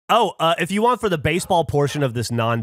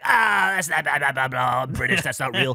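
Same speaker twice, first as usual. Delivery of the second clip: mock dismissive. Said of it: "And he's like".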